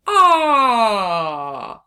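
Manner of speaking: long loud stretched ah; stretched vowel. Shown as a demonstration. Teacher stretching the short O with the class. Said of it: "can we stretch it together".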